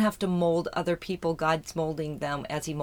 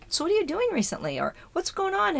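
higher questioning voice of acquaintances; mock curious asker; 12 semitones higher than usual. Imitating people who ask what she has been doing. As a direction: ask brightly like a nosy acquaintance. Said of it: "people would say to me".

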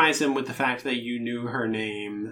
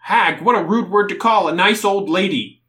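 offended shout; 7 semitones higher, 9 dB louder than usual. Acted. Fish guy offended by "hag.

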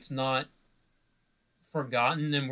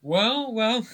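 teasing; 9 semitones higher, 6 dB louder than usual. Real, not acted. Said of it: "teasing before the voice reveal".

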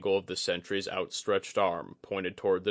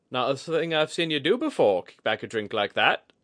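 wryly; 6 semitones higher, 6 dB louder than usual. A narrator's reading. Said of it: "she observed wryly".

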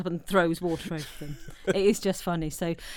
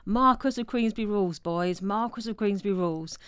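mock shout; 4 semitones higher, 5 dB louder than usual. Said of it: "one of them says".

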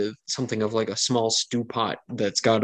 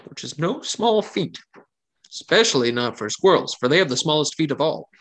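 higher, lilting voice, earnest promises; eager young camp cook; 6 semitones higher than usual. Acted. Cook defends his squirrel stew to wary guests, eager and higher. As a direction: insist eagerly, pitch swinging up. NPC reassures about squirrel.

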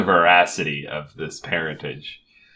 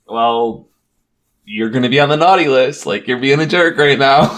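Buddy impression, lightly voiced; 6 semitones higher, 7 dB louder than usual. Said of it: "he's like".

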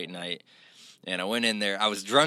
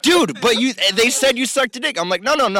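higher, louder retold argument; heated back-and-forth; 13 semitones higher, 7 dB louder than usual. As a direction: insist hard against the teasing. Friends accuse, he denies.

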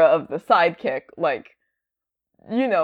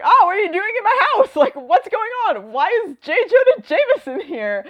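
put-on high, fast, bewildered teen voice; confused young Peter; 13 semitones higher, faster than usual. Peter baffled by Jonah in his house, high and fast. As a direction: squeak it out like a baffled kid.

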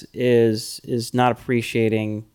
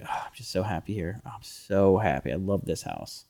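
soft quoted contentment; 5 semitones lower, 10 dB quieter than usual. Retold or imitated. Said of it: "she's like".

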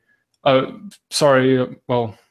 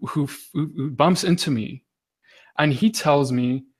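ordinary delivery

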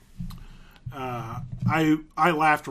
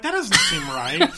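high, slow doubtful thought; mock puzzlement; 11 semitones higher, 3 dB louder than usual. Viewer doubting the film is set in Florida, exaggerated puzzlement. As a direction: draw out the doubt, pitched way up. Retold doubt about the setting.

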